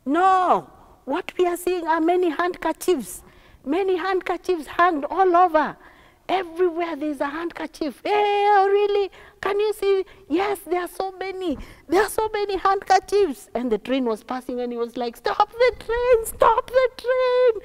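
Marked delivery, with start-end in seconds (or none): 0.0-13.5 high-pitched, raised calling; acted excited clamour; excited shouting. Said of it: "all of a sudden they started shouting". 15.2-17.5 high, loud, drawn-out yell; slow, stretched high shout; urgent cry. Acted. Boy cries out to stop train.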